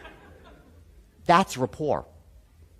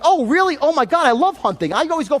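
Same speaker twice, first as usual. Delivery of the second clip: mock gushing salesman; 6 semitones higher than usual. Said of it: "And the guy's like".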